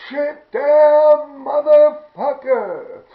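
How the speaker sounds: loud, high, drawn-out holler into a loudspeaker; yelling out. Retold or imitated. Bored friend on the train loudspeaker, yelling out. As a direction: bellow it slowly so it echoes down the tunnel. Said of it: "he starts yelling out".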